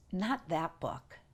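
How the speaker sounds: soft, slow, quiet divine voice; calm inner voice. Retold or imitated. Inner voice of God answers the mother, soft and slow. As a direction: say it gently and slowly. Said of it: "And the voice said".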